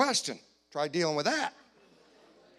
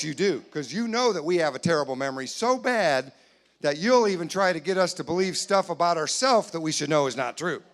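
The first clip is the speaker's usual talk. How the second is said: ordinary talk, nothing marked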